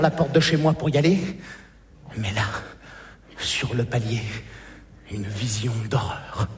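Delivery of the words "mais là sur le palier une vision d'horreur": plus grave et plus doux, lent; dramatique bas. Acted. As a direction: annoncer l'horreur à voix basse. (voix basse dramatique)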